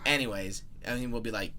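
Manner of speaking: normal talking voice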